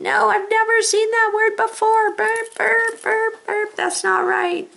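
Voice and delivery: high, loud squeaky alarm voice; alarm in your head. Pretend. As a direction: play a silly brain alarm shouting no. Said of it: "Every alarm in your head should be going".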